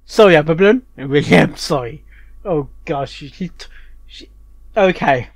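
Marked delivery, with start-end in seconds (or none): none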